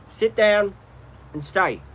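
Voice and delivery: dog-training order; firm command. Real, not acted.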